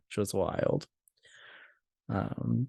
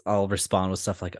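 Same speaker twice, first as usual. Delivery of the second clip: normal talking voice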